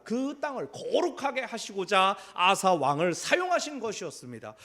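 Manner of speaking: loud, driving sermon delivery; full-force preaching. Acted. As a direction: preach it out to the congregation. Preacher builds the sermon point. (힘 있게 설교하는 목소리)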